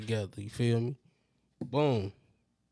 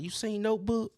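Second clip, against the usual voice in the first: voicing his girl; 8 semitones higher than usual. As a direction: lift the voice to play her casual question. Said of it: "She like".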